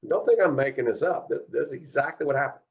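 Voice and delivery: ordinary delivery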